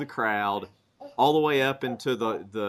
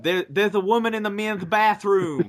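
high, loud Dusty Rhodes impression; 8 semitones higher, 3 dB louder than usual. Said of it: "the famous line from Dusty of".

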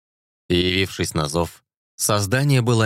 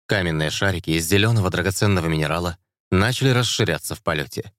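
ordinary delivery

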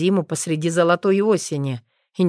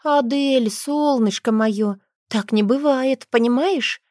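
put-on a higher, patient voice calming a child; a mother coaxing her daughter; soothing mother; 8 semitones higher than usual. A narrator's reading. (ласковая мама)

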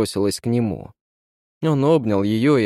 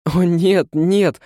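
high, louder cry of refusal; desperate cry; 7 semitones higher, 5 dB louder, much slower than usual. A narrator's reading. Woman begs not to be left alone with him, crying out. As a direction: cry out in acted alarm. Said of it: "вскричала она".

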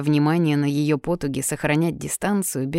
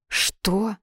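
breathed out; 4 semitones higher than usual. A narrator's reading. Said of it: "выдыхает Алёнка".

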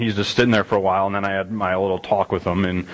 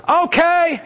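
sudden high yelled okay; mock a kid yelling back on purpose; child's yell; 3 dB louder than usual. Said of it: "his response to me was to yell".

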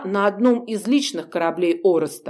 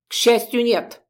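contemptuous spit; 4 semitones higher than usual. Acted. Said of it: "сплюнуло мёртвое существо".

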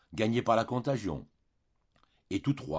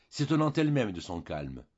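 ordinary delivery

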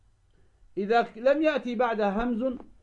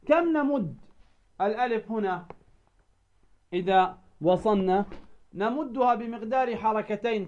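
ordinary talk

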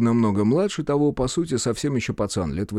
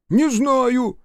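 high, whining protest; aggrieved denial; 13 semitones higher, 4 dB louder than usual. A narrator's reading. Cornered policeman denying he knows anything, aggrieved.

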